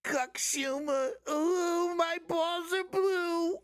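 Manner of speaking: high, slow, squeaky mock Schumer. Mocking Schumer to the chat, high and slow. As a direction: ham up a prissy politician, way up high.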